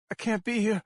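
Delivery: high, loud frightened cry; pleading in fear to be let go; panicked plea. A narrator's reading. Terrified girl begs the unseen captor, panicked.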